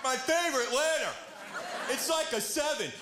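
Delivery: high, dopey contestant voice; dim contestant. Retold or imitated. Comic keeps mocking the Wheel player, high and dopey. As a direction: gush about a letter like a simpleton.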